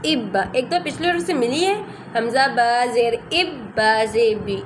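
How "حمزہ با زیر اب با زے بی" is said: "حمزہ با زے اب با زبا" spelled out slowly, syllable by syllable; slow spelling out. Shown as a demonstration. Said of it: "دو دفعہ کیسے پڑھتے ہیں".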